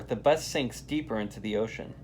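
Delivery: usual speaking voice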